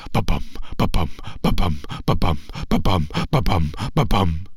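low quick ba-bum drumbeat; deep pounding drumbeat. Acted. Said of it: "beat their drums even faster".

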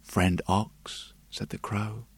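soft, confiding crow voice; hushed secret. Acted. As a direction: share a secret quietly.

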